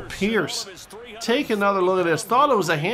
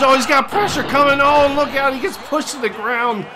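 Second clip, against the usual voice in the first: loud and higher, rising to a shout; excited call; 7 semitones higher, 5 dB louder than usual. Acted. Commentator calling Mac Jones getting sacked, excited shout. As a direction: sell the sack like a hyped announcer. Quarterback under pressure, sacked.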